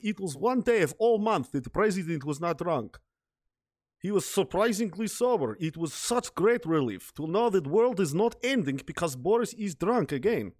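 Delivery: lightly voiced Russian accent. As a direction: tell it like a Russian officer recalling. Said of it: "this Russian guy... he was like".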